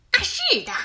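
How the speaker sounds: high-pitched, breezy yes; chirpy confirmation. Acted. Fox confirms the shaving to the wolf, chirpy.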